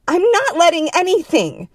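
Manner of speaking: very high, loud shrilling; shrill protest. A narrator's reading. Woman in the other's grip, shrill protest. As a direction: shriek the denial in indignation. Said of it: "the woman shrilled".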